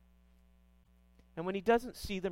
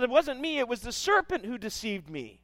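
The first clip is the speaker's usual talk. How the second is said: Eve denying; 6 semitones higher than usual. Retold or imitated. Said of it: "the woman turns around and goes".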